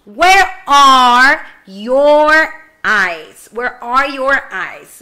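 usual speaking voice

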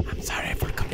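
whispered, tentative apology; soft whisper, breaking off unfinished; hushed false start. Acted. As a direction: start apologizing under the breath. First quiet try before Bane voice.

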